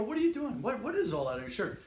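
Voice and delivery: put-on young recruit baffled by a stranger's shirt, asking high and puzzled; puzzled surprise. Recruit to the first SEAL he met, curious and high. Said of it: "I go".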